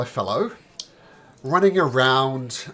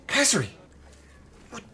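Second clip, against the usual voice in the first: put-on higher, startled call to an old worker; surprised innkeeper; 12 semitones higher, 6 dB louder, faster than usual. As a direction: beckon her in, surprised. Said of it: "Ozar spots Khazri and says".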